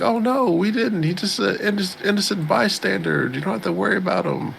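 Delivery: high-pitched imitated cover-up; hasty high-voiced fibbing; mock innocent denial. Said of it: "they're like".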